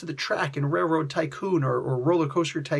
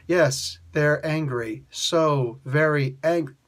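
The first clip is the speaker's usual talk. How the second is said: lower, slow and mock-dramatic; slow, mock-solemn quote; 4 semitones lower, slower than usual. Critic mocks Raven's slow dramatic line, lower and slow. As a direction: drag it out like a dull oracle. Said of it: "Now all of a sudden it's like".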